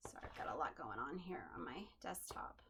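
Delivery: quick whispered apology, off to the side; a hushed sorry under the breath; whispered aside. Real, not acted. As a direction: drop to a whisper to excuse the mess. Apologetic aside about messy desk.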